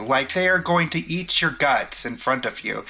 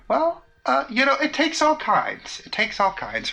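high, lilting sing-song; 9 semitones higher than usual. Acted. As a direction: chirp it like a know-it-all. Kid affecting adult wisdom.

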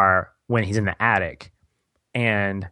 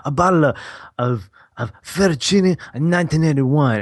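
hammed-up Italian accent, pitched high; 6 semitones higher than usual. Mouth ordering in the restaurant, in a put-on Italian accent. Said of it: "like, this Italian accent".

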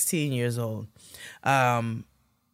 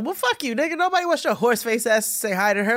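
mock heckle; 9 semitones higher, faster than usual. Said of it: "I was like".

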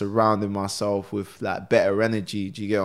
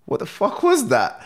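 high-pitched retold disbelief; incredulous, voice leaping up; stunned reaction; 4 semitones higher than usual. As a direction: react as if floored by what you just heard. Retold stunned reaction to hearing song.

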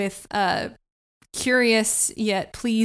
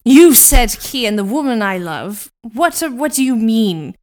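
loud villain; 9 dB louder than usual. Acted. Plays Doctor Frankenstein.

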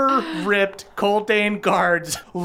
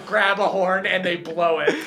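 ordinary talk

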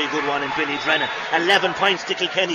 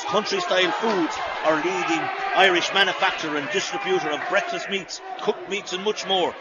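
sponsor read; 3 semitones higher than usual. Acted. Reads sponsor message mid-match.

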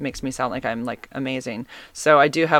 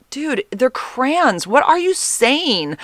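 high, drawn-out disbelief; exasperated correction; 8 semitones higher, much slower than usual. Retold or imitated. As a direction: stretch it out slowly in pleading exasperation. Retold exasperated correction of husband.

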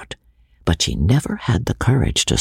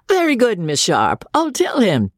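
mocking; 11 semitones higher than usual. A narrator's reading. George mocking Rebecca.

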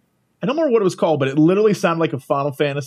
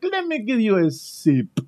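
imitated attendant; 5 semitones higher, slower than usual. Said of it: "she's like".

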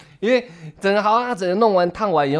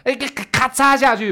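sharp high mock snipping noise, louder; scissor snip sound; 9 semitones higher, 3 dB louder than usual. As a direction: imitate the scissors going snip. 模仿剪刀声. (拟声模仿剪刀咔嚓)

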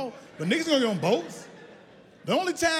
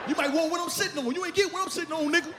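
high-pitched mock bragging; hammed-up high swagger; cocky boast; 7 semitones higher, faster than usual. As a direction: brag defensively with the voice pushed up. Boasts while backing away.

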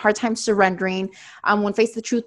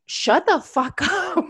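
higher, slow, breaking into a laugh midway; giggling mock command to the inner voice; laughing telling-off; 7 semitones higher, much slower than usual. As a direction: try to be stern but crack up. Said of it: "sometimes I'm just like".

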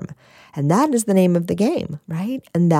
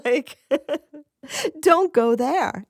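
higher and slow, laughing through it; amused self-warning, higher and slowed; 8 semitones higher, slower than usual. Retold or imitated. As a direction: laugh while telling yourself off. Voicing her anger, laughing.

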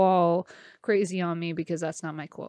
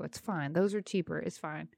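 much softer, lower, almost under breath; muttered aside; 5 semitones lower, 10 dB quieter than usual. Real, not acted. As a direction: toss it off quietly. Throwaway reassurance.

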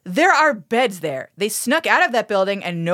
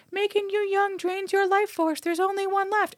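put-on ominous old lady; 10 semitones higher, 5 dB quieter than usual. Quoting the old lady character.

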